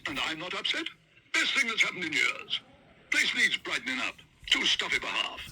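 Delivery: put-on high, hearty old gentleman; Sir Huntley. Sir Huntley defends the bear to the waiter. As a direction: boom it out jovially.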